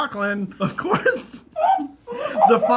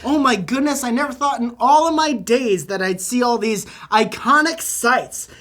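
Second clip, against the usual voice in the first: higher, louder amazed mom; gushing Midwest mom; 5 semitones higher, 7 dB louder than usual. Retold or imitated. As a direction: gasp it out like a starstruck tourist.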